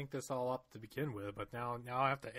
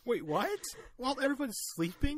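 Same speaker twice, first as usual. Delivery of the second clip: incredulous; 9 semitones higher than usual. Real, not acted. Incredulous question.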